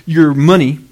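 loud, emphatic mock tirade; hammed-up angry taunt, punching each phrase; frustrated king. King blames the prophet's God for lost pay, frustrated. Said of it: "he was so frustrated".

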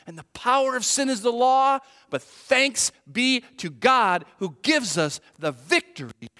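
loud, high, impassioned pulpit delivery; full-force preaching. Real, not acted. Preacher assuring mourners of victory over death, forceful preaching. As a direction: proclaim it to the whole congregation with punched words.

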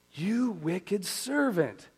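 angry king. Acted. King rebuking the unforgiving servant, slow and angry. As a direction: condemn the servant, drawing out each word. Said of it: "he said".

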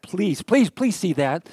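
ordinary talk, nothing marked

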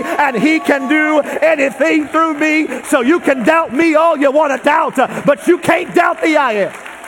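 loud, high fervent preaching; preaching at full force. Acted. Preacher answering doubt with a loud fervent cry. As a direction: hammer the answer home.

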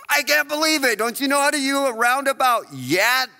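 mock disbelief at a bad driver; exasperated driver. Driver stuck at roundabout.

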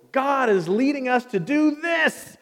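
high, loud, deliberate declaration; bold proclamation. Acted. Husband home announcing God's leading, boldly proclaimed. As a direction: declare it with total conviction.

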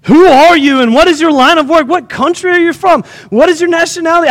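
high-pitched volley of questions; demanding. A narrator's reading. Sailors demand answers from Jonah about the storm, insistent. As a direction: read as an insistent interrogation. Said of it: "they demanded".

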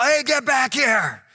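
imitated parent yell. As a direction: yell after the runaway kids. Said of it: "some parent yell down the hall".

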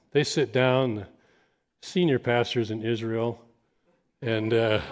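ordinary delivery

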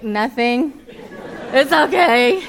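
loud huffy "it's okay" with a laugh; grouchy brush-off. Retold or imitated. To her husband Paul on the couch, a hammed-up grouchy sulk. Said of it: "I get all irritated and grouchy".

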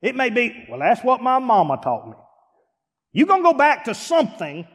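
high, loud preacher's cry about being the odd one; fired-up preacher voice; full-force preaching. Acted.

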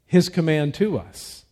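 ordinary talk

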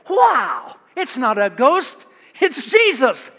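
Peter's thrilled relief at seeing Jesus; excited Peter. Acted. Preacher voicing Peter, astonished and excited. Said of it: "But he's thinking".

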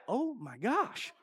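very soft, slow retold aside; stunned, drawn out under your breath; quiet dismay. Retold dismay at fan's outburst.

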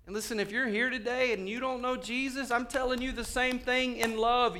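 high-pitched pulpit plea; urgent preaching appeal. Real, not acted. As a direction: press the warning on the listeners. Preaches directly to the congregation.

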